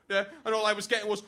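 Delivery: usual speaking voice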